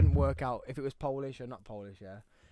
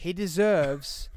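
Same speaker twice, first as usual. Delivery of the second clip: slow, exaggerated English enunciation; 2 semitones higher, 4 dB louder, much slower than usual. Repeating it for a teasing mate, very English drawl. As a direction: draw out every syllable, very English. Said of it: "Very English".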